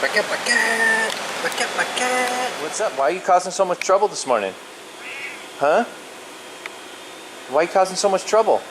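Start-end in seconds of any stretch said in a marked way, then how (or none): 0.0-2.6 slow, high sing-song call; calling a cat by name, high and slow. Real, not acted. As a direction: call the cat's name slowly, coaxing.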